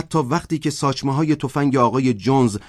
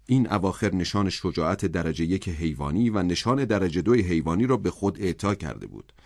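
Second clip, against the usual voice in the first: ordinary delivery, nothing marked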